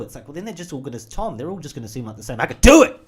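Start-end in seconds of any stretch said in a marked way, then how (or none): 2.4-3.0 high, loud outburst; shout. Retold or imitated. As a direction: blurt it out in a yell. Acted exclaiming outburst.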